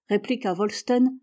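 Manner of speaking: plain reading voice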